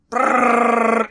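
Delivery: spray the room with a pretend machine gun; machine gun noise. Mimicking Winter Soldier firing his machine gun, loud mock gunfire.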